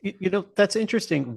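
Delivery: ordinary delivery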